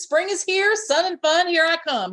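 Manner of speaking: high, loud, bouncy cheer; bunny bursting with joy that spring came; excited bunny. Acted. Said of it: "he says".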